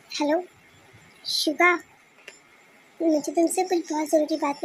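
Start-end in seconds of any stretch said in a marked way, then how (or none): none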